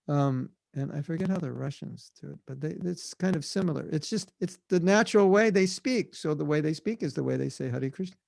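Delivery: ordinary talk, nothing marked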